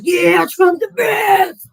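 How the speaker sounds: loud, slow, high dumb cheer; mocking Trump fan. Streamer mocking fans in his box, slow loud cheer. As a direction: yell it like a brainless fan. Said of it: "all just clamoring to come up and be like".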